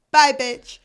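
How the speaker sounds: loud, high sassy send-off; mock loud kiss-off to her father; dismissive bye. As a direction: slam the door with your voice. Vivian telling dad off.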